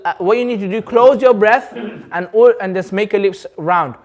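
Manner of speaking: usual speaking voice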